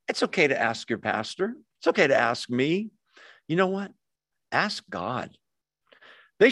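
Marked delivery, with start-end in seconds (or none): none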